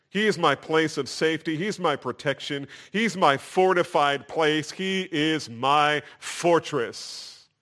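emphatic list, slowing to the end; declaring. Retold or imitated. Preacher voices David naming his refuge, declaring. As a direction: build each phrase into a slow proclamation. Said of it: "David says".

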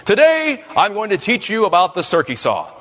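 high, hearty mock-lecture opening; imitated teacher voice on an old tape; father teaching a class. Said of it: "he turned it on, and it says".

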